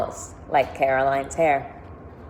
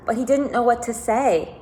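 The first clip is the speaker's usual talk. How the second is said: ordinary delivery, nothing marked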